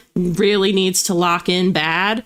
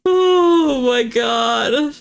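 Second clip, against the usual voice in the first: very slow, stretched-out groan of disbelief; drawn-out exasperation; 5 semitones higher, much slower than usual. Real, not acted. Streamer reacting to the Doom spin, long exasperated drawl. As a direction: drag it out in playful despair.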